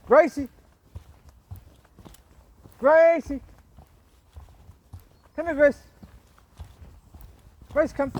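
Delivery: loud, high call across a field; shouting the dog's name. Real, not acted. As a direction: call her back. Calling dog from distance.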